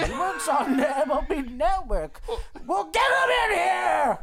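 high, booming holler; bellowing boss. Acted. Boss deciding to bring someone in, bellowing. Acting the shouting team boss.

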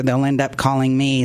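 normal talking voice

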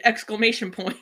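loud retold scream. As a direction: yell the words out. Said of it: "and then screamed".

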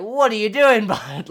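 sharp, loud, high incredulous call-out; calling out a fumbling celebrity, loud; exasperated what are you doing. Retold or imitated. Retelling her reaction to the halftime grab, loud and incredulous. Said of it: "and I'm just like".

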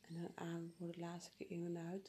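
soft unvoiced whisper. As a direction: whisper the breathing cue. Whispered breathing cue.

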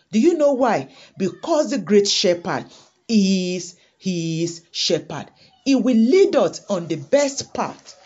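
loud, driving sermon voice; preaching at full force. Acted. Preacher urging the congregation to trust God, loud and fervent. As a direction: press it home to the congregation.

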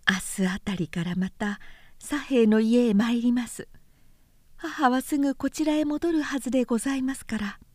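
breathy secret murmur; hushed voice. Acted. Daughter lowers her voice to tell him secretly, whispering. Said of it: "巧みに声を潜めて".

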